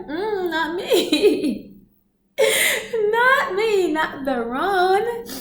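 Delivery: slow, high, broken by laughs; laughing denial. Real, not acted. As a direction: laugh through "not me". Denying through laughter.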